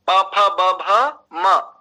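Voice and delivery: प फ ब भ म said very slowly and loud, one by one; lip consonants spelled out. Shown as a demonstration. Teacher drilling lip consonants, slow and loud. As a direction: sound out प फ ब भ म slowly, stressing the lips. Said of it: "ओष्ठ्या".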